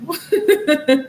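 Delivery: high, loud laugh; sudden high laugh; burst of laughter. Real, not acted. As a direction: laugh out at the messages. Laughs reading the texts.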